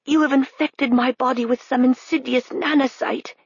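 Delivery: gasped out, high and strained; gasping as if paralyzed. A narrator's reading. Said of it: "he gasped".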